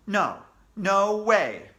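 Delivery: slow, loud no way; stubborn loud refusal. Acted. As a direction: dig in and refuse. Boy refuses.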